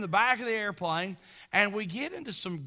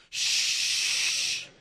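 mother shushing; 3 dB louder than usual. Retold or imitated. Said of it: "her mother's like".

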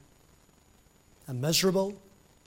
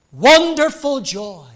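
emphatic; 7 semitones higher, 7 dB louder, slower than usual. Real, not acted. Preacher exalting joy.

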